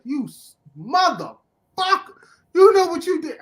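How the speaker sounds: rising to a high, loud outraged yell; imagined yell. Retold or imitated. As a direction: yell at the villain in disbelief. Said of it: "I yelled into, in my head".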